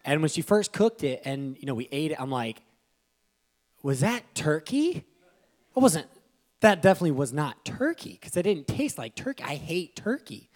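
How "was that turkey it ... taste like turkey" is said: amazed disbelief. Retold or imitated. Pastor retelling his first bite, amazed. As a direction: voice climbs in higher, louder astonishment. Astonished at wife's turkey.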